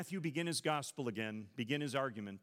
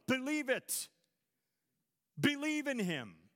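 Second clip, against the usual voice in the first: higher, building sermon appeal; fervent preaching; 7 semitones higher than usual. Acted. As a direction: press the listeners to believe. Preaching at full force.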